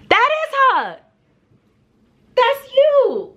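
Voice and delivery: very high, drawn-out taunting cry; mock call-out. Best friend right up in the girl's face, high mock call-out. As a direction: squeal it as an exaggerated identification.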